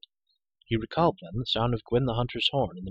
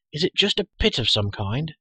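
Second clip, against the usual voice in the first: louder, higher question; calling out; 6 semitones higher, 6 dB louder than usual. A narrator's reading. Girl asking what is below, calling out. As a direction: call the question down. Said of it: "Elinor called".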